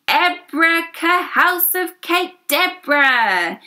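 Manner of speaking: magic spell. Acted. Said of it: "He waved his magic wand".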